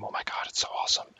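quick breathless whisper of awe; gushing whisper about a favourite game; excited whisper. Real, not acted. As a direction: whisper it fast like a secret thrill.

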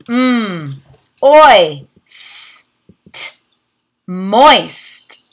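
"Mm, oi, s, t" said as separate sounds, then blended into "moist"; sounding out. Shown as a demonstration. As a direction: segment each phoneme, then blend. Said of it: "practice the oi sound".

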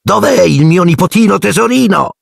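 high, cooing aunt voice; high doting aunt call; gushing Aunt Marge. A narrator's reading. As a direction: gush over the darling nephew, pitched very high. Marge greets her nephew.

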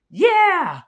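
high, loud shouted cheer; cheering yeah. Shown as a demonstration. Capping a song with a cheer, high loud shout. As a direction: belt it like a crowd cheering. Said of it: "capped by the word".

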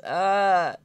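ordinary talk

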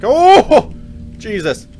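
one loud, very high yelp; real fright, a loud high cry; startled yelp. Real, not acted. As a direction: jolt out a yelp of shock. Sudden scare while playing.

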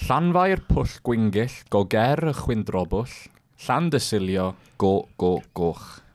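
slow and deliberate, syllable by syllable; over enunciating. Shown as a demonstration. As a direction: spell it out slowly for learners. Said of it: "I'll say it slower as well".

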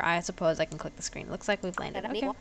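normal talking voice

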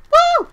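loud, very high whoop; excited whoop. Real, not acted. Gamer celebrating a good catch, loud whoop. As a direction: whoop in triumph.

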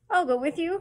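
high, loud and eager; eager cry. A narrator's reading. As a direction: jump at the offer with delight. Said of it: "cried Paul with shining eyes".

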